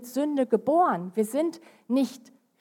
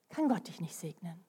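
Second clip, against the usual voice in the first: hushed, unvoiced warning; whispered warning; 6 semitones lower, 10 dB quieter than usual. Real, not acted. Preacher to congregation, a hushed whisper. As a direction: breathe it as a grave secret.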